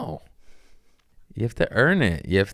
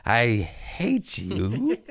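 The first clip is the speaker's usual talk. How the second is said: laughing insult; 3 semitones higher, much slower than usual. Acted. Petty mock-hateful farewell.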